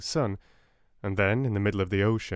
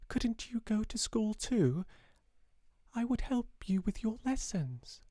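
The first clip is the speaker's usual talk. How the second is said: put-on high, soft child's voice; small girl pleading; 13 semitones higher, 5 dB quieter than usual. A narrator's reading. Sarah at five begging her father to come along, high and soft. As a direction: plead like a five-year-old.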